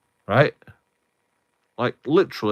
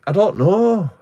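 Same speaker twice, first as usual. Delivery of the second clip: squeaky helpless; 9 semitones higher, 6 dB louder than usual. Retold or imitated. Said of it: "And I'm like".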